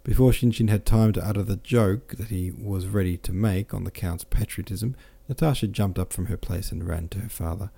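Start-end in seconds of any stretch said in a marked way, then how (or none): none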